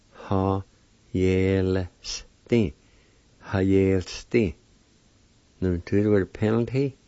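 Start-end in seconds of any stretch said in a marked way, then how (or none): 0.1-2.7 "Ha, yel, sti" in separate syllables, short gaps; "Ha... yel, sti", measured teaching pronunciation; broken into syllables. Shown as a demonstration. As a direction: sound out "Ha, yel, sti" part by part. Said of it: "like this".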